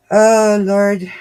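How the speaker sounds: loud exasperated Oh, Lord; exasperated outburst. Real, not acted. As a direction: blurt it out in frustration. Frustrated at tangle.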